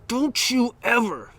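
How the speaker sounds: retold high, forceful scolding; bluffing stern outburst. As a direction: scold in separated, emphatic bursts. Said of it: "to throw in my bluff".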